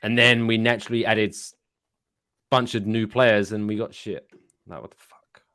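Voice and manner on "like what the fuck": frustrated whispered curse; whispering. Real, not acted. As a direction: hiss it under your breath in despair. Despairing about football team.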